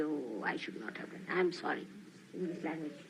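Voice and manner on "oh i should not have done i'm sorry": low, soft, self-pitying sorry; guilty apology. Retold or imitated. Teacher mocking a guilty disciple, low and self-pitying. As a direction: mumble it like a guilt-ridden penitent. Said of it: "You avoid all problems by feeling guilty".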